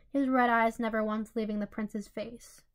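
plain reading voice

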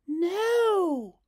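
put-on high, loud desperate child's plea; pleading. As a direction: beg them to stop. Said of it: "pleaded Hazel".

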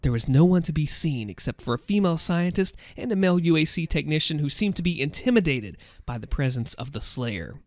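ordinary delivery

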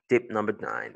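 usual speaking voice

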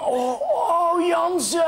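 high, loud crying groan; loud moaning. Acted. Man moaning his name to the policeman.

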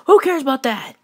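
high, loud, elated outburst; giddy dismissal. Acted. Deadpool too rich to care, giddy shout. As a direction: brush it off at top pitch. Brushes off being lost.

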